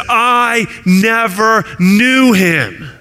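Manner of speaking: high, slow, hammered-out denial; vehement denial. Retold or imitated. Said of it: "he calls down curses upon".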